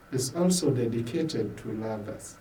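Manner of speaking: ordinary delivery, nothing marked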